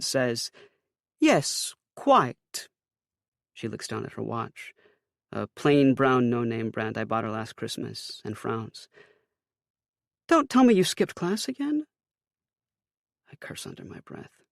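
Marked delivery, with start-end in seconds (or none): 1.2-2.7 a hammed-up bad British accent; mock-posh British, high. A narrator's reading. Mother teasing her son in a bad British accent. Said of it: "her best but worst British accent". 10.3-11.9 high, loud mother's voice; Ma's voice. A narrator's reading. Mother describing her glitter plan to her son.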